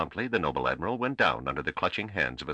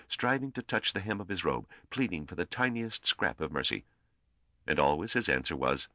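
plain reading voice

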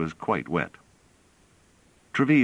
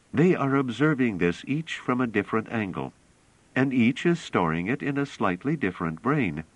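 a hint of a higher, earnest young woman's voice; lightly voiced young woman; 6 semitones higher than usual. A narrator's reading. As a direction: lift the voice a little for Bliss, calm and sure. Bliss voiced by narrator.

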